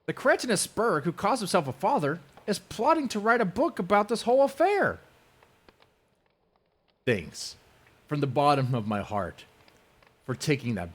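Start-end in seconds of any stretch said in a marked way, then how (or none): 0.0-5.0 high, louder pompous mimicry; mocking imitation. Mocking the rival's insult in a high pompous voice.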